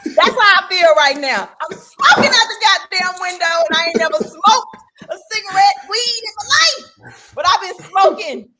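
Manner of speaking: high, loud fed-up outburst; worked-up sports fan venting loudly; exasperated rant. Real, not acted. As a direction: cry out at the top of your range. Fed-up fan rant about team.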